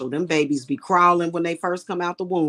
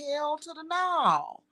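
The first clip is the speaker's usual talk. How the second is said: sassy refusal; 10 semitones higher, 4 dB quieter, slower than usual. Retold or imitated. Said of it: "I just be looking like".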